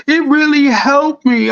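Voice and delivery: high, strained voice near tears; imitating a tearful plea. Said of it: "it looked like she was crying".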